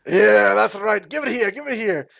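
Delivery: high, exuberant dwarf voice; hearty dwarf. Acted. Dwarf finally getting his hug, boisterously delighted. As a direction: welcome the hug with loud gusto.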